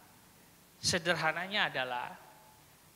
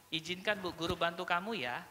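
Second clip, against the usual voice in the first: soft, reassuring teacher voice; gentle offer; 7 dB quieter than usual. Shown as a demonstration. Teacher offering help to a crying child, gently. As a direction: ask permission softly, coaxing.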